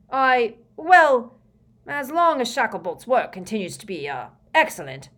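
mildly flustered, hesitant backtracking; lame. A narrator's reading. Prime Minister fumbles an answer to Scrimgeour, mildly lame. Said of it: "said the Prime Minister lamely".

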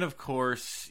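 ordinary talk

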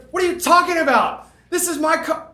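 loud indignant retort; indignant shout. Retold or imitated. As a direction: insist it's yours, loudly. Woman indignantly claims car.

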